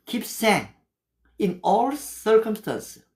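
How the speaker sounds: slow, careful English scripture; slow memorized Bible English; memorized verse recital. Shown as a demonstration. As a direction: say each word deliberately, recited from memory. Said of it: "제가 이렇게 영어를 할 수 있는 거는요".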